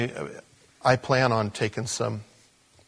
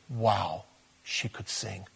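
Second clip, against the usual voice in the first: hushed awe; 2 semitones lower, 7 dB quieter, slower than usual. Acted. Awed repeat of praise.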